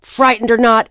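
ordinary delivery

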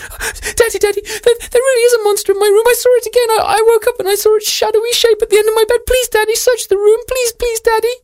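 put-on squeaky high pleading girl; cartoon princess. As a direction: beg in a tiny high voice, fast.

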